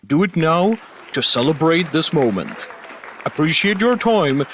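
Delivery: announcer voice. Acted. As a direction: read it like a broadcast alert. Stream alert announcement.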